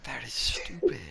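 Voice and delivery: breathy whisper of disbelief. Real, not acted. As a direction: whisper it under your breath.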